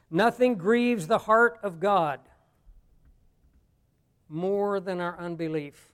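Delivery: weighty, drawn-out statement; slow, emphatic. Real, not acted. Preacher announcing a principle to the congregation, slow and emphatic. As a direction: proclaim it slowly, starting strong and dropping on the last phrase.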